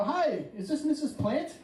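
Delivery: high, slow fake phone caller; put-on caller voice for a prank; disguised voice. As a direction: raise the pitch to hide who you are. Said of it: "I disguise my voice".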